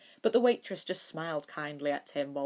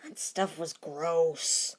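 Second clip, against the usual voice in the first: disgusted; much slower than usual. Acted. Child disgusted by champagne.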